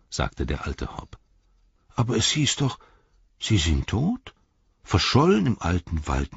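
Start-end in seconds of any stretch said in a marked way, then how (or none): 1.9-6.4 a hint of a flustered old gatekeeper; nervous old hobbit, rambling; lightly voiced timid old man.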